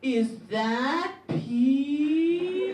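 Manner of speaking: slow, loud, drawn-out question; child outing a classmate to the class, loud; loud accusation. Retold or imitated. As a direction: call it out for the whole class. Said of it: "I go really loudly".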